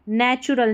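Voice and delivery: "नेचुरल" with ch, nay-chu-ral; correct pronunciation. Shown as a demonstration. Teacher gives the right reading, "नेचुरल" with ch. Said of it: "नेटुरल नहीं पढ़ना".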